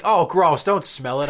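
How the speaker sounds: a squeamish mom recoiling; disgusted mom. Retold or imitated.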